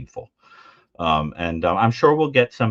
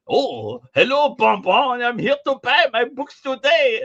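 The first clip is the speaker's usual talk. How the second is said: high, slow, exaggerated French accent; 12 semitones higher, 4 dB louder, slower than usual. Fake Frenchman buying books, ridiculous French accent. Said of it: "sounding like [name]".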